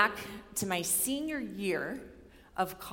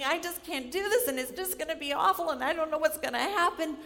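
anxious pleading; 8 semitones higher than usual. Retold or imitated. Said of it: "I'm in his office, and I'm saying".

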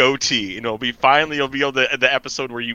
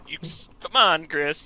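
usual speaking voice

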